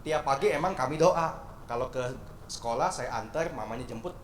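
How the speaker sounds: usual speaking voice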